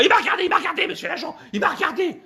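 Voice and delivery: high-pitched mock-female wail; accusing woman. Woman to officer about being looked at, accusing wail. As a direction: wail the accusation in comic falsetto.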